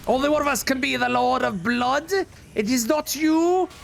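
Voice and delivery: grandiose evil overlord taunt; dark lord. Acted. Self-proclaimed Lord of Blood taunt.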